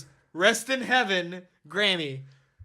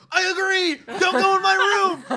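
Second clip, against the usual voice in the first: high indignant; 13 semitones higher, 6 dB louder than usual. Retold or imitated. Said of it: "And I'm like".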